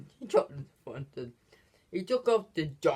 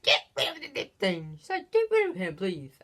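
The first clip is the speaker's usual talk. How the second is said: much higher pleading mock voice; high-pitched cartoon girl demand; imitating the girl; 4 semitones higher than usual. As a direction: play her begging to be rid of him.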